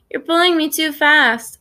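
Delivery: put-on high-pitched little-kid whine; understated whiny protest; whining. A narrator's reading. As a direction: whine a complaint, pitch lifted. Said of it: "Isaac whines".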